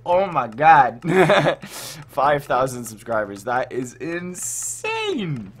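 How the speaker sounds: usual speaking voice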